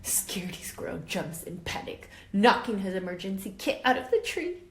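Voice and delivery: lively, expressive read-aloud voice; reading to children. A narrator's reading. Teacher reading a story to her class, lively and expressive. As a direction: bring the picture book alive for the class.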